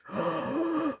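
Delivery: sharp, very high, soft gasp; a quick shocked intake of breath; dramatic gasp. Acted. Storyteller gasping at the evil flytraps taking the planet.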